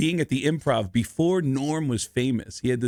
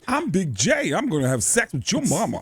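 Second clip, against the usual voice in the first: higher, sing-out put-on character voice; Big J catchphrase; 6 semitones higher than usual. Shown as a demonstration. Host performing his catchphrase for the kids, put-on voice. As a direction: deliver the silly boast on request. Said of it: "now Darcy's asking me to do it".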